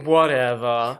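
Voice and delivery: low, dismissive "whatever"; bored, indifferent imitation; not giving a fuck. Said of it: "looks like he does not give a fuck".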